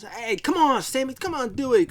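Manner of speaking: high, loud, pushy pleading; bullying coaxing. Retold or imitated. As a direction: pester him into it, acted out. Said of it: "Kinda bullied him".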